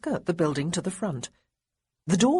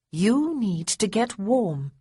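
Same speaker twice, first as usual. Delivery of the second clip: slow, firm, insistent command; brooking no protest; 4 semitones higher, 3 dB louder, slower than usual. Acted. Sarah ordering her chilled cousin to warm up, slow and firm. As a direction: lay it down slowly, no room to argue. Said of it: "in a voice that brooked no protest".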